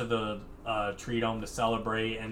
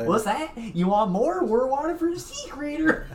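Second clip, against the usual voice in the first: put-on Texas accent; 6 dB louder than usual. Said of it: "Sandy quote".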